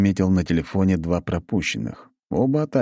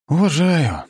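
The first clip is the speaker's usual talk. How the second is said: higher, louder, drawn-out resigned admission; resigned sigh; 11 semitones higher, 5 dB louder, slower than usual. A narrator's reading. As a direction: concede it with a weary sigh. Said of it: "вздохнул я".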